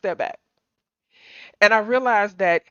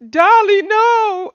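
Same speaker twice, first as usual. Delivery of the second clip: much higher and louder mock wail; imitating a wailing friend; 10 semitones higher, 11 dB louder, much slower than usual. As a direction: wail it like the heartbroken friend on the phone. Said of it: "screaming, crying".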